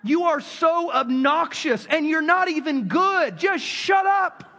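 high, loud and slow, ending in a shout; slow furious scolding that bursts into a yell; mean telling-off. Retold or imitated. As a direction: snap at the mouthy kid to shut up. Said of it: "I was really that mean to the kid".

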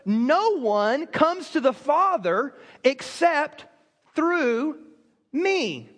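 very slow, with pauses before the last words; slow solemn declaration. Acted. Pastor quoting Jesus' claim, very slow and weighty. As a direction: hold out through and me. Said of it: "He goes".

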